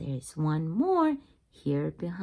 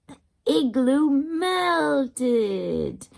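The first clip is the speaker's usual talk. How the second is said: slow reveal; 4 semitones higher, 4 dB louder, much slower than usual. Acted.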